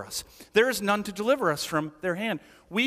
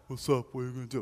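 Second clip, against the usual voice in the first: put-on teen boy voice; 8 semitones lower, 7 dB quieter, much faster than usual. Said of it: "Boys are the opposite".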